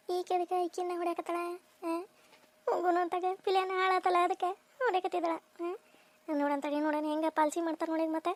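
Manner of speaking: gossiping aside. Acted. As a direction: lean in and whisper the gossip.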